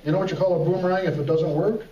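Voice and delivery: normal talking voice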